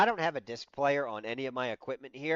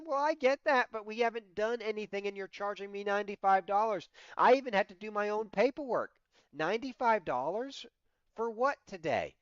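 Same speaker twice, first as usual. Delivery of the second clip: indignant complaint; 8 semitones higher, 3 dB louder than usual. Retold or imitated. Arguing with receptionist over fee.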